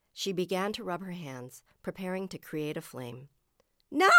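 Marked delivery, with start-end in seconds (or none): 3.9-4.1 sudden cry to stop someone; alarmed shout. A narrator's reading. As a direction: cut in with a panicked no. Lunging to stop her sister.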